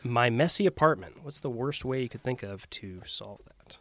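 ordinary talk

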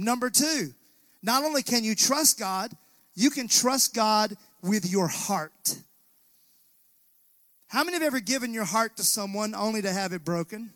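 ordinary talk

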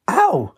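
high, sharp "ow"; pained cry. A narrator's reading. Lynx hit by a bowls ball, pained cry. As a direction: yelp as if struck on the foot. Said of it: "Lynx hopped in pain".

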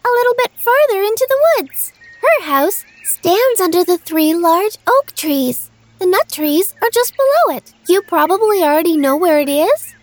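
put-on high, quick girl voice; little girl voice. A narrator's reading. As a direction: chatter brightly as a small girl.

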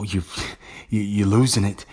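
ordinary talk

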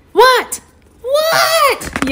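very high, squealed demand to know; squeaky storybook yell; kitten yell. A narrator's reading. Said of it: "yells Kitty".

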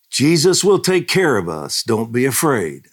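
put-on high, loud comfort to a scared child; reassuring grandmother. Grandmother calming her scared grandson, loud reassurance. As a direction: reassure the boy over the noise. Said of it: "I told him".

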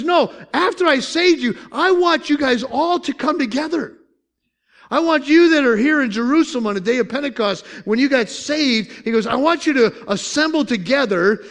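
high, loud, urgent summons; God's fervent call. Retold or imitated. Preacher voicing God gathering the saved at Pentecost, loud fervent call. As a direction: call them together with fervour.

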